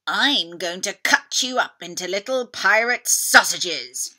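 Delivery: loud, greedy beast yelling a threat; dinosaur yell. Acted. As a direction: bellow it like a hungry T-Rex. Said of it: "yelled the Tyrannosaurus Rex".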